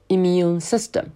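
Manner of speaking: "immune" starting with a plain "ee" sound, not "in"; slow "immune system", open ee at the start; clear i vowel. Shown as a demonstration. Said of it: "Try to follow with me". ("immune"开头读成E的音，不是in)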